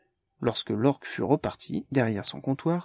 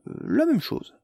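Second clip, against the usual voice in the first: high, hesitant voice; hesitant order; 14 semitones higher, 3 dB louder than usual. Acted. Carmalière ordering the same, high and hesitant. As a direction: pitch it up and hesitate.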